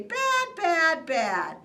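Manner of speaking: very high, loud mocking "Bad"; mocking Lorax. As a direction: mimic his nagging. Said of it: "yap, and say".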